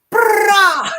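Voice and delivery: loud, high trumpet-like "brah" with a laugh; blurted fanfare noise. Retold or imitated. Friend replaying the punchline on Aaron, loud blurted fanfare noise. Repeats the John Cena joke noise.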